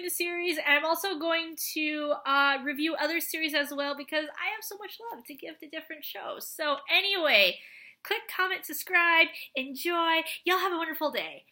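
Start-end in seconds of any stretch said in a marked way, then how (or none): none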